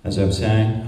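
ordinary talk

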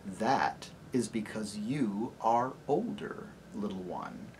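low, soft and deep lion; gentle great lion greeting a child; low, tender lion voice; lion's voice. Acted. Aslan voiced.

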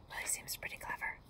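very soft whisper; whispered aside. Real, not acted. Reader commenting on the wolf to listeners, whispered aside.

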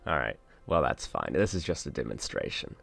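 usual speaking voice

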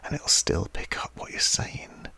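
hushed ASMR-style whisper. Shown as a demonstration. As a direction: whisper softly, close into the microphone. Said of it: "you can basically start talking really quietly".

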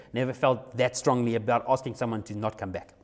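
ordinary talk

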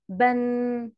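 "بن" on a level pitch, ending in a hummed n; audible final nun. Shown as a demonstration. Teacher has pupils listen for tanween, final n ringing in "بن". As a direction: hold the final n of "بن" like a ringing bell. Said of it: "أنا هنطقها بالشكل ده".

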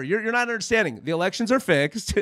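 normal talking voice

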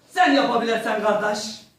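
a hint of an Azeri acting teacher; Azeri accent. Azeri acting teacher urging him to play Hamlet, mild Azeri accent. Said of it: "böyle bir şiveli".

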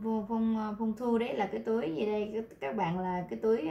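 ordinary talk

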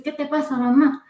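high-pitched worried question; a touch of alarm, voice lifted; alarmed grandson. Retold or imitated. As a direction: lightly imitate a startled child checking on someone. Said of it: "Mi nieto Bruno entra asustado".

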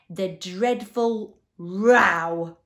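very loud, slow, drawn-out wail; anguished cry. A narrator's reading. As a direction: cry it out, stretching every word in anguish. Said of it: "cried the anguished Din".

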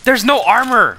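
high, loud complaint; exasperated protest. Real, not acted. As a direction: protest loudly at the game. Protests missing armor in game.

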